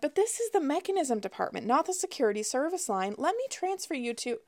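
high, sing-song service-desk sweetness; syrupy sweet representative. A narrator's reading. Rep transferring a misrouted caller, syrupy sweet. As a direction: redirect the caller in a high sugary voice. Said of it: "high-pitched and syrupy sweet".

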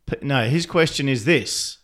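ordinary delivery

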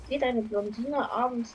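ordinary delivery

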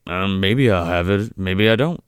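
low, offhand imitation; unbothered doctor. Host imitating the doctor answering a frantic plea, unbothered. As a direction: answer low and noncommittal, toying with them.

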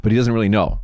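ordinary talk, nothing marked

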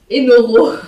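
mots mêlés de rire; en riant. Real, not acted. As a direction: rire en finissant la phrase. Rire après avoir roté. (en pouffant de rire)

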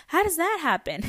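high, loud baffled question; playfully astonished, pitched right up; retold amazement. Client asking her therapist about the nap, retold amazement.

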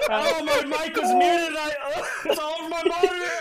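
high, loud laughing rant; laughing hard. Real, not acted. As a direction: shout it through helpless laughter. Laughing after spit-take.

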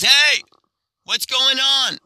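high, loud call from a window; annoyed shout. Acted. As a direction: holler at the noisy people.

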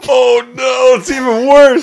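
high, loud disbelieving cry; excited shout. Real, not acted. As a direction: shout in delighted horror. Reacting to the twist.

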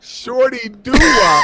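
slow, high, loud laughing disbelief; incredulous. Real, not acted. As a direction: echo it back in total disbelief. Incredulous echo of nickname.

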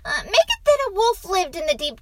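put-on high, loud, fast child voice; insistent boy. A narrator's reading. Boy pushes father for wolf, insistent. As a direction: rush out the request as a boy.

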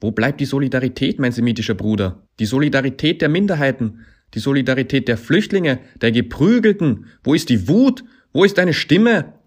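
high, loud harangue rising to a shout; impassioned appeal, building. A narrator's reading. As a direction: build each question until it becomes a yell. Rallying demand, building to a shout.

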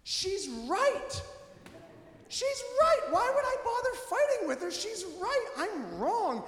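high-pitched, exultant admission of fault; hammed-up joyful surrender; joyful repentance. Husband conceding his wife is right, joyfully repentant. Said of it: "here's the joy of repentance".

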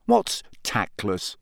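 slow, low puzzled question; slowly, not understanding; bewildered. A narrator's reading. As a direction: ask it in mild confusion.

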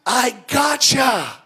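high, loud mocking gotcha; gloating landlord. Landlord jumps out at praying tenant, loud mocking gotcha. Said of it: "he goes".

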